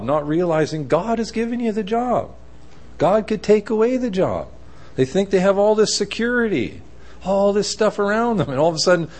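high-pitched, urgent sermon delivery; worked-up preacher, pitched well above normal; impassioned preaching. Real, not acted.

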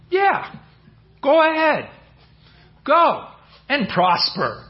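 high mocking go-ahead, dripping sarcasm; sarcastic fake blessing, pitched high. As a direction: say it like you mean the opposite. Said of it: "some kind of sarcasm or something there".

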